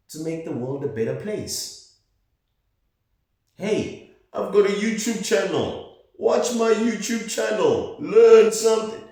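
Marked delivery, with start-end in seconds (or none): 3.6-9.0 much higher, louder squeaky mock pitch; mocking YouTuber voice. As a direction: parody a pushy channel promoter. Mocking self-promoting YouTubers.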